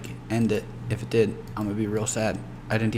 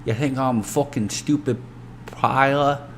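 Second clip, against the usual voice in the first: lightly voiced wacky street accent, rough edge; 3 semitones higher than usual. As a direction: play a sketchy dealer with a silly accent. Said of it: "I'm not gonna do an accent now".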